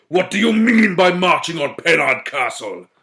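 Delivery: loud demanding challenge; stern warlord. A narrator's reading. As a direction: confront the intruders. Mowbray confronting the fairies.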